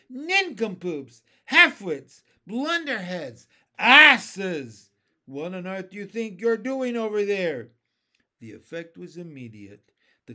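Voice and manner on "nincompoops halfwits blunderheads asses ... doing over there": loud, high-pitched volley of name-calling; yelling insults. A narrator's reading. Centipede yelling at the Cloud Men, loud jeering shout. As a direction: bellow each insult across a distance. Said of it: "was yelling at the Cloud Men as loud as he could".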